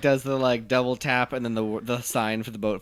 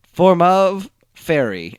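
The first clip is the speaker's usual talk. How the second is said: slow heroic call; 5 semitones higher, 7 dB louder, much slower than usual. Retold or imitated. Wonder Twins call, slow loud.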